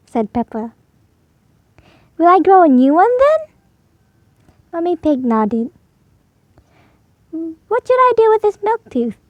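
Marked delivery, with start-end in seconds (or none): 2.2-3.4 higher, louder eager question; hopeful Peppa. Acted. Peppa asks Mummy about new teeth. 7.7-9.2 put-on much higher little girl voice; curious Peppa. Peppa asks Mummy about the milk tooth.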